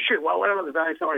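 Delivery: high, scornful throwaway; dismissive sneer. Retold or imitated. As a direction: wave it off with contempt. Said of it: "felt he was kind of rude and hostile".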